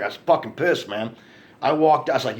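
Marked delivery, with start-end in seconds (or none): none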